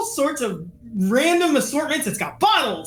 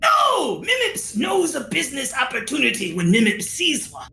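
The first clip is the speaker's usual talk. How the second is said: Mimips. Acted. Merchant defending his business sense, indignant creature voice. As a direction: protest in an excitable creature voice.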